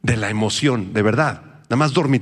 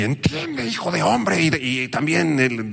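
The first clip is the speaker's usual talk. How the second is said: a touch of the stern angel; 5 semitones higher, slower than usual. Retold or imitated. Angel addresses Daniel.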